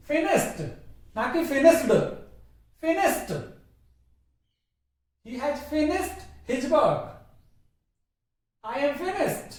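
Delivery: slow finished ending in t; correct pronunciation. Shown as a demonstration. Teacher modelling sentences for students. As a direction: say फिनिश्ड as finisht. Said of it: "टे पे खत्म करो".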